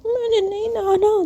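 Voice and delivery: put-on tearful childish pleading; childish whine. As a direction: whine like a cold little kid refusing a bath. Maya whines about bathing.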